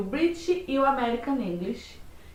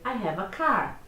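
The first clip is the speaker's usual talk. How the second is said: American accent, rolling the R in car; American English, strong final R; 4 semitones lower, slower than usual. Shown as a demonstration. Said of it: "o americano ... ele vai dizer".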